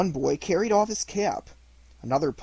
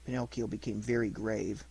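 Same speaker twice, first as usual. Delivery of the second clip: ordinary delivery, nothing marked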